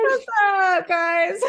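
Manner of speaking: loud, high-pitched hello back; exuberant greeting. Real, not acted. [name] greeting everyone on the call, exuberant shout. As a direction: holler it high, breaking into laughter.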